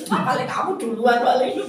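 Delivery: loud, laughing mock accusation; envious teasing. Envious friend doubting her story, loud mock accusation. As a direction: scoff in mock jealousy. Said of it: "Umi itu iri".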